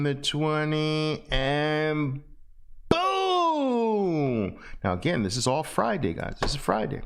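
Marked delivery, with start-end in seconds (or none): 2.9-4.6 long cry of boom sliding high then falling; high drawn-out boom. Shown as a demonstration. Teacher making his favorite trade noise, long and high. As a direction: let the boom ring out like a cry. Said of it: "making and hearing that noise".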